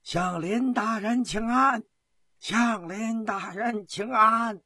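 high, drawn-out communal call; long, high chanted greeting; crowd's echoing cheer. A narrator's reading. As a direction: call out slowly as a whole crowd answering. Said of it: "呼喊声惊天动地".

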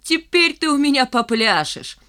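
высокий выкрик из-за забора; пригрози издали, злорадно; угрожающий крик. A narrator's reading. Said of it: "закричал Валька из-за забора". (злорадно грозит криком)

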